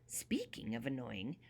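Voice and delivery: whispered aside. A narrator's reading. Conspiratorial aside.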